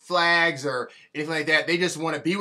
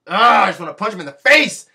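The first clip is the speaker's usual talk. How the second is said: louder angry burst; frustrated outburst; 8 dB louder than usual. Real, not acted. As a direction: let the anger boil over.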